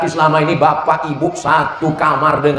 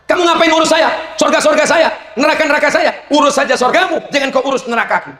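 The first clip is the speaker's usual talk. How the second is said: defiant husband; 13 semitones higher than usual. Pretend. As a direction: snap back defiantly at wife. Husband retorts angrily.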